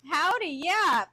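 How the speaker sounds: ordinary delivery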